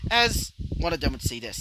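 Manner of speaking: ordinary delivery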